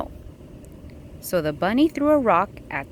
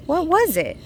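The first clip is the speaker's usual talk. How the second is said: 1 excited wondering; 9 semitones higher, 3 dB louder than usual. A narrator's reading. Reading suspense question to child.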